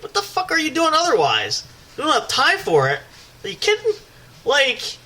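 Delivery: exasperated, rising way up; incredulous rant. Real, not acted. Ranting to friends about his excuse, high incredulous voice. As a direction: pitch jumps high in disbelief.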